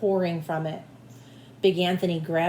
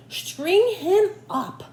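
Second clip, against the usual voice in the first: higher, louder, slow angry call; angry shout; 11 semitones higher, 4 dB louder, much slower than usual. Acted. Townsmen turn on Big Anthony, shouting angrily. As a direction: demand punishment. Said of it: "the men of the town shouted".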